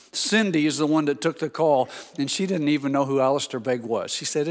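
ordinary delivery

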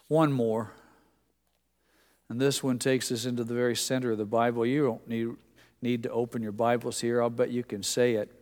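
usual speaking voice

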